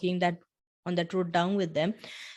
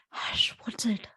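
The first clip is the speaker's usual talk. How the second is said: put-on half-whispered, higher hush to a child; hushed shushing; 4 semitones higher, slower than usual. Hushing a crying child during a client call, half-whispered. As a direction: whisper it, as if covering a phone call.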